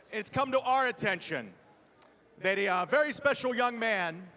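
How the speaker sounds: ordinary talk, nothing marked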